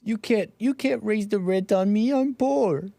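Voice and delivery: high pitched mock whine; mock whiny pleading. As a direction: whine like a broke tenant. Mock poor person pleading.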